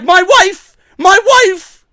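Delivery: high, loud frantic wail of my wife; mock panic. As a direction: ham up Drake's panic over his wife. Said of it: "And then he's like".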